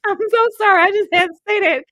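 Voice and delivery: high, loud, breaking into laughter; real laughter spilling through the sorry; laughing apology. Real, not acted. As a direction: apologize while cracking up. Laughing apology after California Raisin joke.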